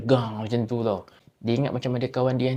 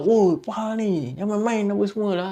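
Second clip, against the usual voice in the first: frightened yell; 8 semitones higher than usual. Retold or imitated. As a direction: yell after being thrown back by the door. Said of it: "Dia jerit lah". (berteriak ketakutan)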